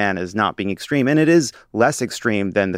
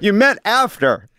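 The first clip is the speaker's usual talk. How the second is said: higher, louder, slow repeat; incredulous emphasis; 9 semitones higher, 5 dB louder, slower than usual. Real, not acted. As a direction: hammer the point in disbelief.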